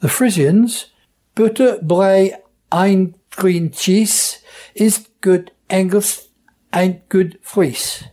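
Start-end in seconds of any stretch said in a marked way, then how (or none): 1.4-8.0 slow, mild Frisian accent, admittedly not good. Shown as a demonstration. Dialect speaker reciting the Frisian version in a Frisian accent. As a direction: try the rhyme in a Frisian accent, slowly. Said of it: "my Frisian accent is not very good".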